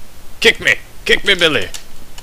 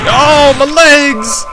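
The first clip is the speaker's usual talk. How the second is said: mock anguish; 8 semitones higher, 6 dB louder, much slower than usual. Character's legs go limp.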